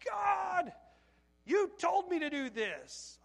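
high, loud cry, slowing into accusation; yelling at God. Retold or imitated. Worker at failing tree lot, yelling at the Lord. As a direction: shout it upward in frustration. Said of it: "I've never yelled at the Lord".

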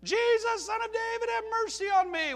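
high, loud pleading cry. Retold or imitated. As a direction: cry out even harder. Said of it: "he shouted all the more".

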